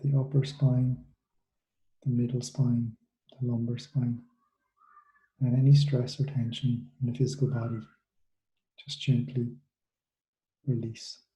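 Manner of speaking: soothing meditation guide, unhurried and hushed; guided meditation. Acted. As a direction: ease them gently through the body scan. Leads a guided body-scan meditation.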